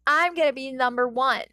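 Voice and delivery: loud, high little bully; bossy kid boast. A narrator's reading. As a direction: brag it like a cocky six-year-old. Kacchan boasting.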